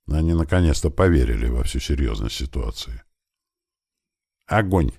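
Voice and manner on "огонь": curt, higher-pitched order; short command. A narrator's reading. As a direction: give the order briefly. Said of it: "коротко сказал я". (коротко скомандовать)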